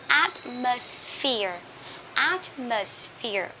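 slow "Atmosphere" with the middle "s" hissed out long. Shown as a demonstration. Teacher drills learners on middle S, slow hissed S in "Atmosphere". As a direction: draw out the hissing S inside "Atmosphere". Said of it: "Mình nhớ những âm S ở giữa này các bạn vui lòng xì ra".

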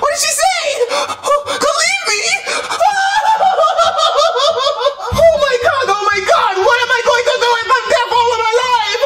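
high, tearful shouting; panicked wailing. Acted. Cook left alone fearing deafness, panicked wail. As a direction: wail in despair, voice climbing.

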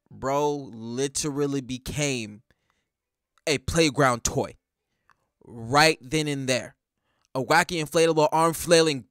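low, slow, hushed and spaced out; drawn-out deadpan amazement; slow deliberate disbelief. Real, not acted. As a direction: let each phrase land separately. Savoring the humiliation in awe.

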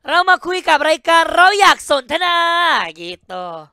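high, loud, sing-song Thai phrase; Thai phrase demo. Shown as a demonstration. Showing the host how the Thai phrase sounds, high and loud. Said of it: "Bahasa Thailandnya apa?".

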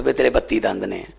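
lightly voiced mother cautioning her son; mother's voice. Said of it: "ਮੇਰੀ ਮਾਂ ਕਹਿ ਦਿੰਦੀ ਹੈ".

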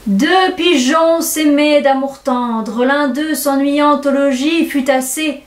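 slow declamation, vowels drawn out; récitation théâtrale. Acted. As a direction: recite the fable grandiloquently, like old tragedy. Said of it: "Je repris". (déclamation lente et grandiloquente)

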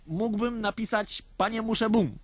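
put-on high, eager kid's suggestion; eager child voice. A narrator's reading. Boy offering the father a greeting, eager and high. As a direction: pipe it up brightly like a boy with an idea.

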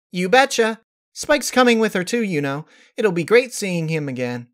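high, bright and bouncy pony; bubbly Pinkie voice. A narrator's reading. Pinkie answering Rarity, high and bubbly. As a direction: play her upbeat and eager.

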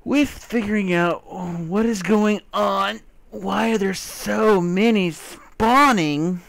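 high, loud and drawn out; excited. Real, not acted. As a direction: stretch it out, all hyped up. Vlog sign-off, voice jumps.

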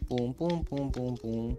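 repeated "pum" sound effect; spoken booming noise. Pretend. Player imitating thuds while walking, imitated booming.

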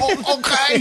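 high mock-Cuban catchphrase; hammed-up Scarface-style Cuban accent; fake Cuban accent. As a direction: parody a bad Cuban accent. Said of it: "He kept saying".

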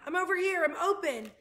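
eager, loud, high call. A narrator's reading. Martin signals his brother for a throw, calling. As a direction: holler for a catch. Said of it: "called Martin".

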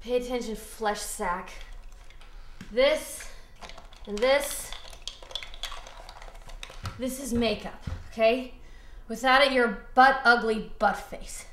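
put-on a dead girl giving a makeup lesson; zombie Stacy. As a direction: zombie coaching herself, low and soft.

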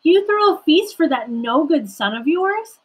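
high, loud, indignant storybook voice; angry protest. A narrator's reading. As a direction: protest to father in acted outrage. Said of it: "he was angry".

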